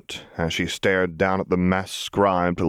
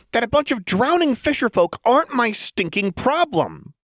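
high, strained manic voice; agitated Jinx; 3 dB louder than usual. A narrator's reading. Jinx complaining to Lux about Fishbones, high agitated voice. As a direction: push it up high and tight.

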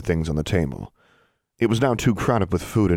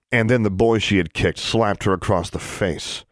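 plain reading voice

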